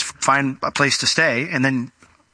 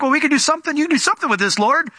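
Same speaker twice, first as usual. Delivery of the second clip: rising hope; 12 semitones higher, faster than usual. Retold or imitated. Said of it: "he rises up to think".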